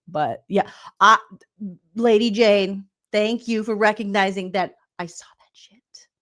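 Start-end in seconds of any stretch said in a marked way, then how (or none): 5.0-6.1 whispered confiding thanks. Real, not acted. Whispered aside.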